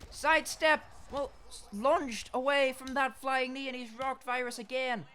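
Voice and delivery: high, loud play-by-play yell; excited shout. Real, not acted. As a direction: call the hit with a burst of excitement. Excited fight commentary.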